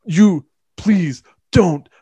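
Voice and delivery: very slow, loud, high mock line reading; stilted, overblown movie dialogue; hammed-up bad acting. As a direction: parody a terrible actor, dragging each word. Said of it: "talks like they're reading words off a page".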